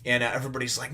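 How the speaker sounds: ordinary talk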